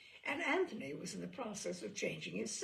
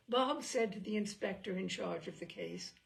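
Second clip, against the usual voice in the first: plain reading voice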